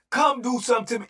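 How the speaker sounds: escalate, throw it harder. Retold or imitated. Repeating the dare to the pod, he shouts it harder.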